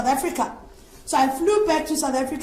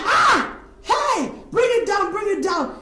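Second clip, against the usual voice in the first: retold shout; 7 semitones higher, slower than usual. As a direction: bark it like an angry pastor. Pastor shouting at her.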